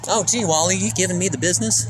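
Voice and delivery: put-on high, far-off fifties sitcom kid voice; Leave It to Beaver voice. Streamer mimicking Beaver teasing his brother Wally, high and bright. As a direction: chirp it like a sweet fifties TV kid. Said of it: "Leave It to Beaver style".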